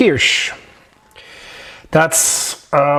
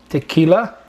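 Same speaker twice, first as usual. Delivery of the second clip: ordinary talk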